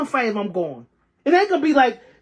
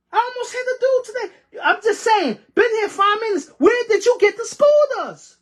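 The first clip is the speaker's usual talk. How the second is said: higher, fast, incredulous rant; real exasperation, pitched up and rushed; exasperated outburst; 9 semitones higher than usual. Real, not acted. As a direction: fire off the questions in disbelief. Sudden exasperated scooter rant.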